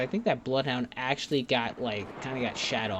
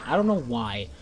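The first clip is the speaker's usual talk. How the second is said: ordinary delivery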